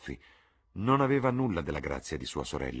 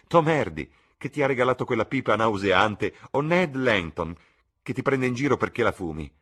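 put-on much higher, louder eager girl's voice; excited young sister; 5 semitones higher, 7 dB louder than usual. A narrator's reading. Sibyl thrilled at a walk with Jim, eager chatter. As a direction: chatter on brightly to your brother.

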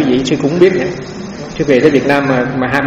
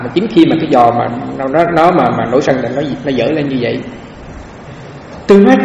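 ordinary talk, nothing marked

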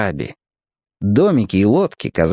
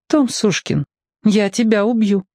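sad; 10 semitones higher than usual. A narrator's reading. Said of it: "печально пообещала".

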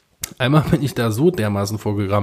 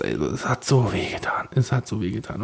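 pained whisper; 4 semitones lower, 6 dB quieter than usual. Retold or imitated. Recalling painful crushed toe.

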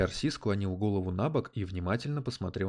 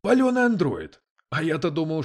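high, loud excited discovery; astonished exclamation; 8 semitones higher, 8 dB louder than usual. A narrator's reading. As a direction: exclaim it in amazement. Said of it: "воскликнул Дэвид".

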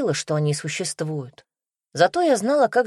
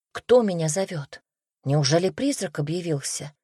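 plain reading voice

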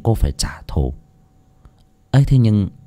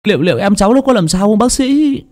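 anxious plea; 11 semitones higher, 3 dB louder than usual. Acted. Nephew asks doctor anxiously.

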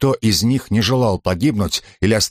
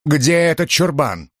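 angry; 6 semitones higher, slower than usual. A narrator's reading. Jovina swearing, furious.

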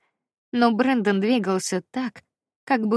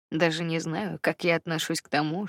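ordinary delivery

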